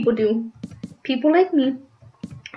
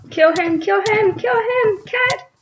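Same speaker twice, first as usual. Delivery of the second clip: urgent urging; 9 semitones higher, slower than usual. Real, not acted. Player urging cat teammate to kill him, urgent rising cries. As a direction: pitch climbing higher with each repeat.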